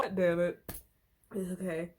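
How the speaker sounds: ordinary talk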